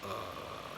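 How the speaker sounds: "uh" very low and soft; long, low, quiet "uh"; low third tone. Shown as a demonstration. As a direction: sink "uh" to the bottom of the voice. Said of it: "third tone should be much more kinda lower".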